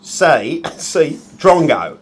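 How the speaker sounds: broad, put-on Aussie accent; Australian accent. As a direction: ham up the Australian answer options. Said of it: "That's enough offending every Australian person".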